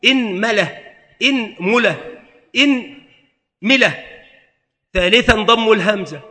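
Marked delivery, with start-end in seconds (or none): 0.1-4.0 إنملة with kasra on the hamza, mim a, u, i; slow drill of إنملة; vowel variants. Shown as a demonstration. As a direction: say إنملة as inmala, inmula, inmila, the last drawn out. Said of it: "وفي الميم ثلاثة أوجه".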